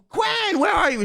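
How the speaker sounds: high-pitched mock yelling of an all-caps message. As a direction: squeal her name like she's yelling at you. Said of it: "like she's yelling at me".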